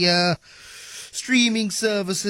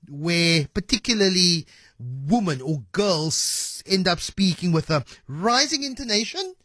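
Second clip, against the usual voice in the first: ordinary delivery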